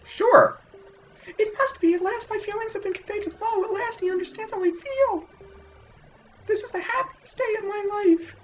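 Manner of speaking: hammed-up falsetto of a swooning village girl; high-pitched girly voice. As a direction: squeal it out like a lovestruck girl. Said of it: "my high-pitched girly voice".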